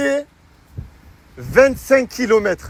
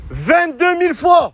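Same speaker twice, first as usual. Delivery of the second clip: excited reveal; 6 semitones higher than usual. Acted. Host reveals the right answer.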